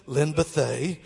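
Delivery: loud stadium announcer call over the crowd; booming announcer. Retold or imitated. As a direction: boom the names like a PA announcer. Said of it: "they said my name louder than anybody else's".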